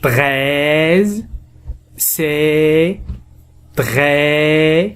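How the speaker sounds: "Treize" and "très" with long held open vowels. Shown as a demonstration. Teacher showing the open sound, vowels in "Treize" and "très" drawn out. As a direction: stretch the e, letting it rise at the end. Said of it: "A مفتوح".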